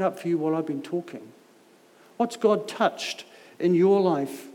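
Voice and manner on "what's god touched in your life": ordinary talk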